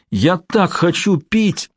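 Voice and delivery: high, slow anguished wail; desperate thirsty moan; pained cry. A narrator's reading. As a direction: drag the plea out in agony. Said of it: "крики звучали всё громче".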